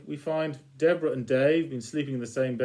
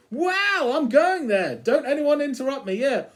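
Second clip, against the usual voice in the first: much higher, thrilled and eager; squealing with delight at the news; the Cat rushing off to find his match; excited Cat; 10 semitones higher than usual. Retold or imitated. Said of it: "your opposite will be".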